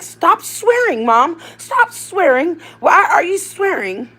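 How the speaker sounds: high, loud imitated child pleading; put-on piercing kid voice, scolding a parent; Dino. Child telling mom to stop swearing, mock high scolding. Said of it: "Dino be like".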